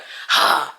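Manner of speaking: sharp huff of exasperation; exasperated sigh. Retold or imitated. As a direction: let out an impatient sigh. Acting out impatient wait, sighs.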